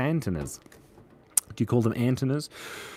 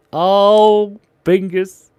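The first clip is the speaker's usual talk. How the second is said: delighted cry; 6 semitones higher, 9 dB louder, much slower than usual. Real, not acted. Fond loud exclamation at drawing.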